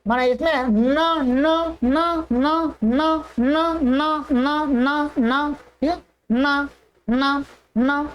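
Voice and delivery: न repeated with nasal resonance; teacher shows the nasal न to students; demonstrating na. Shown as a demonstration. Modelling न through the nose. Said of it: "नासिका स्थान".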